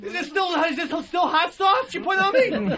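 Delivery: high yelling broken by laughs; frantic yelling. Acted. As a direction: scream in panic, half laughing. Burning, begging for water.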